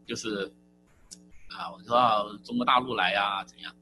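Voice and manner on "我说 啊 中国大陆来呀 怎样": normal talking voice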